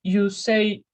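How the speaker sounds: ordinary delivery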